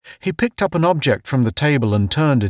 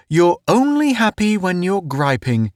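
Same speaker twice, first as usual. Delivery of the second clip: higher, good-natured ribbing; 9 semitones higher than usual. A narrator's reading. Town ribs Pace about griping, high and friendly. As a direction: tease him kindly, in a high voice. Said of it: "Town said good-naturedly".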